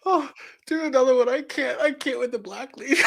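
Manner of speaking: high, crying with laughter; can't stop laughing; laughing helplessly. Real, not acted. Cohost cracking up at reading.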